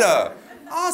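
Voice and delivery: ordinary talk, nothing marked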